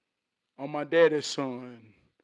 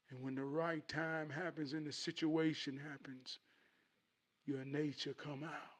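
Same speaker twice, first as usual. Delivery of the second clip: soft, lightly voiced dad; explaining father; 11 dB quieter than usual. Father explaining the family burden to son, soft and grave. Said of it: "He said".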